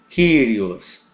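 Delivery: "Kyrios" said wrongly as "kúrios", with Y as U; wrong pronunciation. Shown as a demonstration. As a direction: mispronounce "Kyrios" as koo-ree-os. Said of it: "não é correta a pronúncia Kyrios". ("Kyrios" pronunciado errado, "kúrios")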